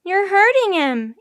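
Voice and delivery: high, loud, drawn-out protest; slow high-pitched yell. A narrator's reading. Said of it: "Annie yelled".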